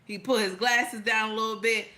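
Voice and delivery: ordinary talk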